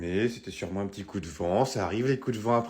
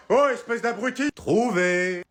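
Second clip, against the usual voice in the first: higher, louder triumphant find; killer gloats; 11 semitones higher, 8 dB louder, slower than usual. Acted. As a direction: crow that he's been found. Le tueur découvre la cachette. (tueur triomphant, plus aigu et fort)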